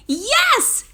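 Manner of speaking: high, loud, thrilled yes; girl's thrilled shout; excited exclaim. Acted. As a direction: burst out with joy like a little girl. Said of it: "exclaimed Maya".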